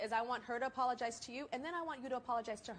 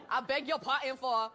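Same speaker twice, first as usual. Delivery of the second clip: mocking the apology; 6 dB louder than usual. Shown as a demonstration. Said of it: "Let's try again".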